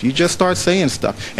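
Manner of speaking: slow emphatic repeat. Shown as a demonstration. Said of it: "repeats phrase for emphasis".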